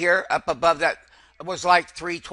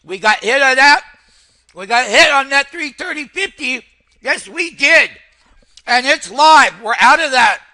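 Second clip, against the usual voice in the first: higher and louder, triumphant; excited; 5 semitones higher, 5 dB louder than usual. Real, not acted. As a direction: crow about the winning fill. Celebrating filled order live.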